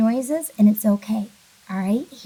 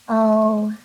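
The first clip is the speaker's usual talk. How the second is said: louder letdown oh; disappointed oh; 5 dB louder than usual. Shown as a demonstration. Teacher acting let down about the cookies, louder oh. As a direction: sigh out oh, let down. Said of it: "This one looks kinda depressing".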